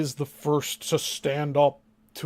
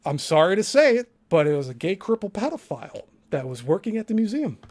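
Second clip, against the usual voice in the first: ordinary talk, nothing marked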